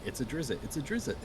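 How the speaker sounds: half-hearted cry of alarm; mock scream. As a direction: imitate a kid fleeing, without really screaming. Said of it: "the kid runs away screaming".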